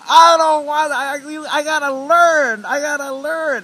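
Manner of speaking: put-on high, loud, slow whiny kid's complaint; a student fed up with his teacher, whining high; fed-up Daniel. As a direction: whine it like a fed-up teenage student. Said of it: "he got fed up with Mister Miyagi".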